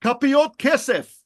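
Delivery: high, slow mock shout; imitated yell. German guard yelling the code at the Ethiopian, mock shout. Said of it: "the German yells at the Ethio".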